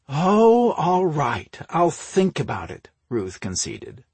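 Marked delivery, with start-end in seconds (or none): 0.1-2.9 high, drawn-out, put-upon concession; dramatic sigh. A narrator's reading. Sister conceding to her brother, dramatic sigh. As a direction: give in theatrically, falling from a high start. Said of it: "with a dramatic sigh".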